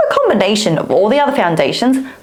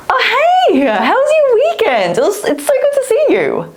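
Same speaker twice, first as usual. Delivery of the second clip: high friendly greeting; 14 semitones higher than usual. Shown as a demonstration. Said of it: "I can go high pitch".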